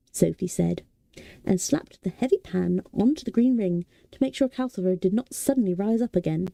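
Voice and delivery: ordinary delivery, nothing marked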